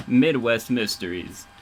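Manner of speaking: ordinary talk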